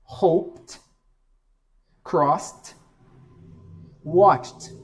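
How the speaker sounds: "Hoped, crossed, watched" each ending in a crisp T. Shown as a demonstration. Teacher demonstrates unvoiced ed endings. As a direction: end every word on a T. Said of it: "Som de T".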